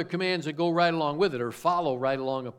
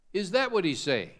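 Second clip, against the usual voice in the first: ordinary delivery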